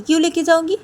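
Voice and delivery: high, loud indignant question; affronted protest; defiant challenge. Acted. Ruhi refuses to carry the gift.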